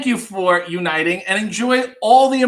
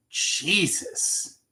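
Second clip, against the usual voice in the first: whispered; 9 dB quieter than usual. Real, not acted. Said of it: "Jesus (unvoiced)".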